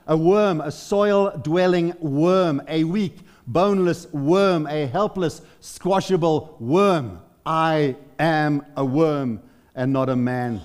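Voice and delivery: very slow, drawn-out preaching; weighty, deliberate declamation; slow, heavy emphasis. Acted. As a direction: stretch each word to dwell on the humiliation. Dwelling on Christ's humiliation as worm.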